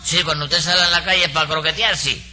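usual speaking voice

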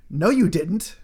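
ordinary delivery

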